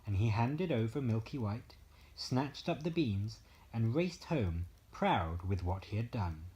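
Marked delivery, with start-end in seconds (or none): none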